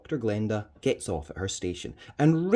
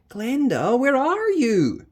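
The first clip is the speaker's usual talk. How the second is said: high, loud voice, slow on the question; high worried call; Charisse calling; 7 dB louder, slower than usual. Acted. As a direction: call her anxiously, pitched up.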